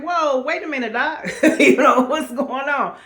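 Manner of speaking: high, laughing whoa; laughing disbelief. Retold or imitated. Reacting to the doctor's news.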